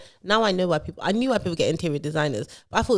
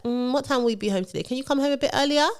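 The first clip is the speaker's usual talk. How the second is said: higher-pitched, lightly wheedling plea; coaxing request; 7 semitones higher than usual. Retold or imitated. Builder asking Lola to come home, coaxing. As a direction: ask sweetly high, imitating a partner.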